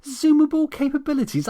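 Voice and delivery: high, loud mocking echo; indignant mocking repeat; incredulous mockery. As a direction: throw his words back in disbelief. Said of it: "I snapped".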